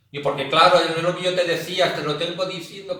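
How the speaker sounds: a voz en grito, más agudo; suéltalo gritando como quien discute. Pretend. Imita otra vez hablar gritando. (a gritos, acalorado)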